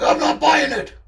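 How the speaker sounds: high, loud, menacing refusal; Charlie's menacing voice. Acted. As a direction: shut them down with loud menace. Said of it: "that voice does sound menacing".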